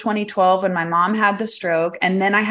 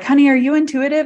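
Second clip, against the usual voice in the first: knowing psychic; 6 semitones higher, 4 dB louder, faster than usual. Retold or imitated. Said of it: "she was like".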